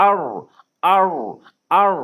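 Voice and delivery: heavy r with rounded lips; round-mouthed r. Shown as a demonstration. Teacher demonstrating a rounded heavy r sound. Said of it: "মুখটা গোল করে".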